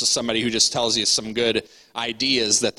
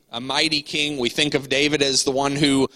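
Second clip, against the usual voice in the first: ordinary talk, nothing marked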